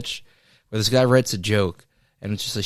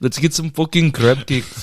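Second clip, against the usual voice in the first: Indian accent impression; 5 semitones higher, 4 dB louder than usual. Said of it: "he had an Indian accent".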